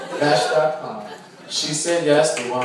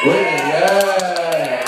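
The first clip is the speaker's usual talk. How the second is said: cheering; 4 semitones higher, 8 dB louder, much slower than usual. Real, not acted. Cheers back at school mention.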